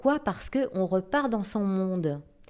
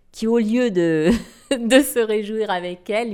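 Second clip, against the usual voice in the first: laughing; 3 semitones higher, 7 dB louder than usual. Real, not acted. Laughs while telling the story.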